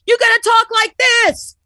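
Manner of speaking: high, strained yell; shouted at the top of the escalation; one-upping shout. Shown as a demonstration. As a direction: outshout the other talker. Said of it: "it goes for volume".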